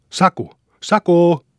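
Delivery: loud, high shouted name; shouting out the window. A narrator's reading. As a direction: yell for the boy from the window. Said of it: "huusi Lukkari akkunasta".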